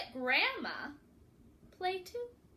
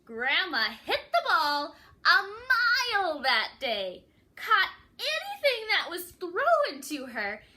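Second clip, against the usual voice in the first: triumphant; 4 semitones higher, 7 dB louder than usual. A narrator's reading. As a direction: ring it out like a legend. Triumphant climax.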